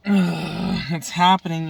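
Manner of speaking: slow, loud, drawn-out dramatic opener; dramatic announcement. Acted. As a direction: announce it like doom is coming. Dramatic vlog opening announcement.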